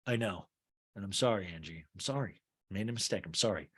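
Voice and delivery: soft, low mock apology; switching tactics. Jen switching tactics on Angie, soft and low. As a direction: drop it to a quiet, sincere-sounding sorry. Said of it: "this is called switching tactics in acting".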